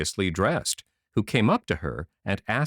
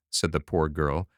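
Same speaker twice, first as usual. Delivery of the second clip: ordinary delivery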